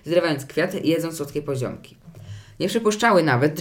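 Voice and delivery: ordinary delivery, nothing marked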